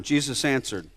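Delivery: ordinary talk, nothing marked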